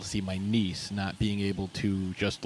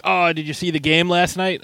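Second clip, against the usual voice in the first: chatty; 8 semitones higher, 8 dB louder than usual. Retold or imitated. Mock office small talk.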